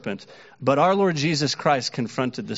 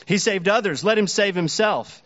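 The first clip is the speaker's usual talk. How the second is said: higher, sneering scoff; mocking; 6 semitones higher than usual. Preacher voicing the rulers taunting Jesus, mocking. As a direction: throw the taunt up at the cross.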